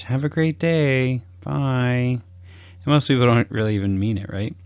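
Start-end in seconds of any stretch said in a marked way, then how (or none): 0.0-2.2 slow, dutiful goodbye; overused farewell. Retold or imitated. Coach mimicking a stock goodbye, slow and dull. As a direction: say it like you've said it a thousand times. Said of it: "It's just used way too much".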